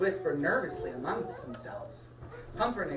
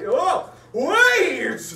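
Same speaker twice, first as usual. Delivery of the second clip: loud, menacing villain call; scary voice; 9 semitones higher, 12 dB louder than usual. A narrator's reading. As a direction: boom it out to frighten. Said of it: "said a scary voice".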